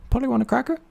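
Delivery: very high parrot squawk, held back in level. Acted. The parrot calls again from under the shirt, very high. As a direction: screech it like a bird.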